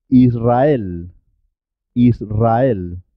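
Israel with a rolled R; double R after S. Shown as a demonstration. Teacher models R after S in Israel, trilled. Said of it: "pero suena doble".

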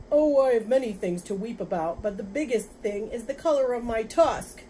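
loud, lamenting animal voice; woeful rhinoceros. Acted. Rhinoceros telling the father its sorrows, woeful. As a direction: bemoan it loudly, pitch slightly lifted.

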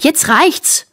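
high-pitched indignant outburst; snarling. A narrator's reading. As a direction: snap that you've had enough. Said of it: "faucht Anna". (empört fauchend)

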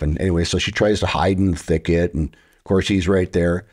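ordinary talk, nothing marked

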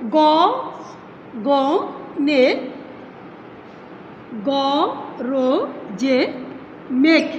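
slow syllable counting. Shown as a demonstration. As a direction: tap out গ, গ, নে, গ, র, জে, মেঘ one beat each. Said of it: "দেখো এখানে খেয়াল করো". (মাত্রা ভেঙে ভেঙে উচ্চারণ)

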